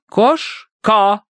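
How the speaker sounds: high, firm verdict; categorical. A narrator's reading. Madame concludes the spirit's word, categorical. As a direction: pronounce it as final. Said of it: "безапелляционно заключила мадам".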